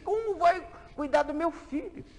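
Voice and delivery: mãe aflita. Acted. Mãe desesperada no hospital.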